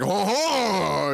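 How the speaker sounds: jolly fat Frenchman laugh; big fat French guy. Retold or imitated. Mocking a big fat French guy with a lightly voiced laugh. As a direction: boom a hearty ho ho like a fat Frenchman. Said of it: "big fat French guy who's like".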